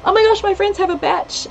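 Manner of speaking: high-pitched gushing recalled reaction; high, eager quote of yourself; excited retelling. Retold or imitated. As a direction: act out your own excited "oh my gosh". Acting out own excited reaction.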